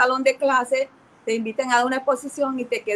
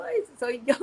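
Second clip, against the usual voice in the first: laughing realization; 4 dB quieter than usual. Retold or imitated. Realizing she must preach.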